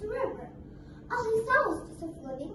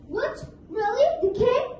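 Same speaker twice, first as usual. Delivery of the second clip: loud, higher amazed crocodile; surprised; 5 semitones higher, 7 dB louder than usual. Acted. Crocodile hearing about the king, loud surprise. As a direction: gasp at the news.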